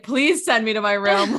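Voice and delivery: hammed-up plea to be sent away; pleading exclamation. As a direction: beg for the timeout, half laughing. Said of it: "And I'm like".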